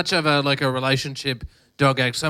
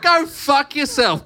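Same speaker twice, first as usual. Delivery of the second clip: high, slow mock yell; retold angry comeback; 11 semitones higher, 3 dB louder, much slower than usual. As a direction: fire back, drawing out each word. Retells own angry reply to lady.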